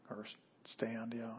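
whispered to himself; whispered aside. Real, not acted. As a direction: drop to a whisper. Aside muttered to self.

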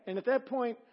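ordinary talk, nothing marked

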